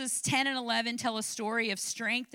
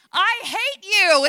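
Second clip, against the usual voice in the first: loud, slow, higher-pitched outburst at the trainer; mock shout; 8 semitones higher, 13 dB louder, slower than usual. Pastor reliving telling her trainer she hates him, in a loud mock shout. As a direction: yell it slowly while straining. Retold fight with gym trainer.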